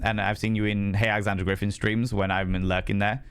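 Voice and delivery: ordinary delivery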